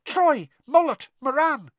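very high squeaky voice; squeaking. A narrator's reading. Supporter's rosette squeaks the players' names, squeaking. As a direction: squeak each name out like a talking badge. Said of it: "kept squeaking their names".

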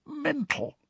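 high, hushed croak on one word; soft high-pitched croak; croaky whisper. Acted. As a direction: squeak it out softly in dread. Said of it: "he said in a croaky whisper".

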